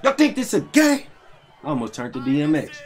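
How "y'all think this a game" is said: a shrill mock challenge; high mock tough voice. Gamer taunting the opponent in a high voice.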